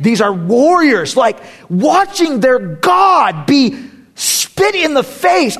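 high, loud and slow, swelling with passion; a charged crescendo over warrior angels; rising fervor. Real, not acted. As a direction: build it up like a revival preacher. Preacher builds to fervor.

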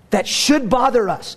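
ordinary talk

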